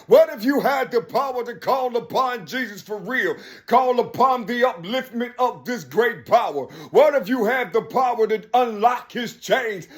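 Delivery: high, loud fiery sermon voice, building and calling out; fiery preaching. Acted. Preacher urging believers to call upon the power, fiery and loud. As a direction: preach it at full force like a revival pulpit.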